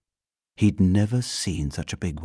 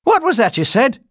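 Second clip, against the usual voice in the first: loud, high-pitched challenge; sharp housekeeper; 10 dB louder than usual. A narrator's reading. Housekeeper turning on the bear, sharp.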